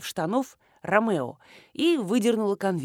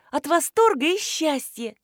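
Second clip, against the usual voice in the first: high-pitched tearful delight; sobbing for joy; 7 semitones higher, 3 dB louder than usual. A narrator's reading. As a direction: gush it out with an acted sob. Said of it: "всхлипнула Нинуша". (всхлипывая от счастья)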